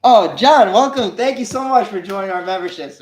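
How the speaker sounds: high, loud burst of delight; excited welcome. Real, not acted. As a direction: greet the new member with a big cheer. Greets a new channel member.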